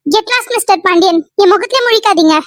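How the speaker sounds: cutting, angry rejection; angry outburst. Acted. Woman telling Mr. Pandian to get out of her sight, angry. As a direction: send him away in anger. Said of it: "கோவத்துல பேசியிருப்பாங்க".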